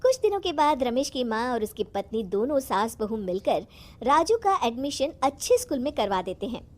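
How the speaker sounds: ordinary delivery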